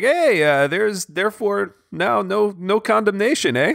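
mock Canadian accent, high and drawn out; hammed-up Canadian reading scripture, slow; playful Canadian accent with a tacked-on eh. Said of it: "the Canadian voice on Dwell".

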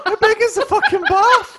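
high, loud incredulous question; amused outburst. Real, not acted. Co-host reacting to the bath story, amused outburst. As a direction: burst out loudly in amused disbelief.

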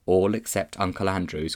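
ordinary delivery, nothing marked

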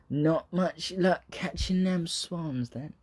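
a fan doing a clumsy Cornish drawl; bad Cornish accent. Mocking a passerby by the river in a bad Cornish accent. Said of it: "That's a really bad one".

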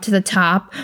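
usual speaking voice

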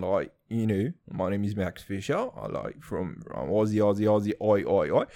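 hammed-up Aussie accent; low-pitched mock Australian twang. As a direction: put on a broad, joking Australian accent. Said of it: "try to portray an Australian accent".

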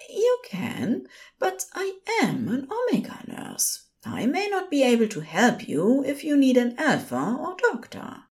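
high-pitched, courteous nurse; polite nurse. A narrator's reading. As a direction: reassure the caller politely in a high voice.

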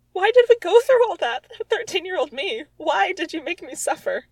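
mock anguish. Real, not acted. Blaming her thirteen-year-old self, loud mock anguish. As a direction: wail to the heavens over her writing.

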